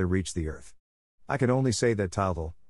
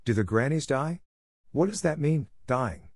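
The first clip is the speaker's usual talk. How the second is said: put-on higher, questioning child voice; lightly voiced curious little boy; inquisitive child; 6 semitones higher than usual. A narrator's reading. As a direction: ask with a child's innocent puzzlement. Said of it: "the inquisitive child kept asking him questions".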